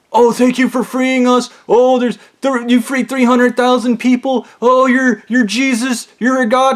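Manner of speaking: high, breathless gushing praise; gushing church women. Retold or imitated. Church women calling to gush with thanks. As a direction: gush like adoring church ladies. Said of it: "And they're like".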